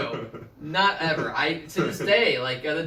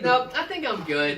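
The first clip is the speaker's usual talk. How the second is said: much higher, breezy brush-off of "I'm good"; dismissive mom; 8 semitones higher than usual. Retold or imitated. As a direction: wave the son off lightly, pitched up on "good". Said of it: "the tone of voice she had".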